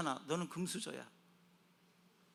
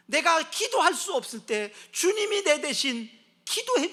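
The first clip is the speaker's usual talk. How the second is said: loud, high, impassioned voice; fervent preaching; 9 semitones higher, 10 dB louder than usual. Acted. Preacher telling how the Lord prays for me, fervent. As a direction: pour it out at full force.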